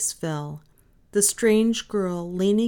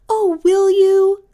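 put-on very high, loud, drawn-out girlish plea; delighted cry; 14 semitones higher, 13 dB louder, slower than usual. A narrator's reading. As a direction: cry out hopefully as a thrilled little girl. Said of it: "cried the little girl".

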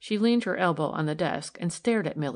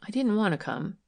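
ordinary delivery

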